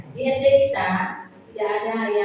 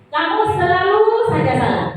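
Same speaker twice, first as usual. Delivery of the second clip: high-pitched scolding; 7 semitones higher, 3 dB louder than usual. Acted. Teacher scolding a pupil who always gets it wrong, in a raised high voice. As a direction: pitch up, blaming a pupil who keeps failing. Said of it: "nada suaranya tinggi".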